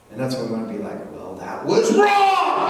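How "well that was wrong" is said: much higher, slow hammed-up scold; mock scolding. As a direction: overdo the outraged parent. Parent scolding caught kid.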